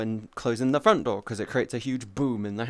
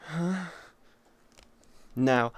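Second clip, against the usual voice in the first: ordinary delivery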